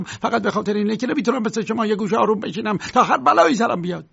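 high, fast indignant outburst; indignant protest. Acted. Block clenches his fists and answers K., indignant protest. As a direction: build from protest to high-pitched agitation.

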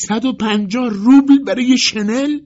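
high, loud, drawn-out cry of disbelief; shocked yell rising into a question; astonished shout. A narrator's reading. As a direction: shriek the price back, slow and high. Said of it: "آکاکی با تعجب داد زد".

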